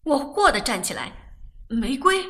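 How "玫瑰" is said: loud, higher cry of surprise; jumping up at an unexpected visitor; startled exclamation. A narrator's reading. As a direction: call out the name in surprise. Startled to see Rose at door.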